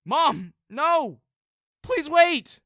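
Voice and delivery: desperate high shout, very loud; yelling after her. A narrator's reading. Son yelling after his departing mom, desperate shout. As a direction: scream it after her as she leaves. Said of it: "I quickly yelled after her".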